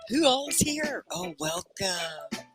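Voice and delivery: normal talking voice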